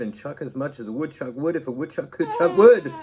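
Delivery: giggling at the end of the twister; laughing. Real, not acted. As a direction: laugh creeps in as it goes.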